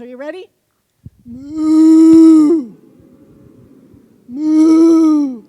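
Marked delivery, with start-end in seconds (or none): 1.2-2.7 big, much louder moo; cow moo. Shown as a demonstration. Speaker leading the audience in being cows, loud moo. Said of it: "Now we're gonna be cows". 4.3-5.4 big, much louder moo; cow moo. Shown as a demonstration. Speaker leading the audience in being cows, loud moo. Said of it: "Now we're gonna be cows".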